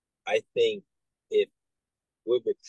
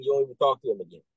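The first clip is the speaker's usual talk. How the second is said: usual speaking voice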